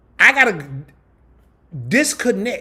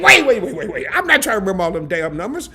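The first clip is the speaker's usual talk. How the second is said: exasperated interruption; 6 semitones higher, 7 dB louder than usual. Retold or imitated. Cutting off the support agent.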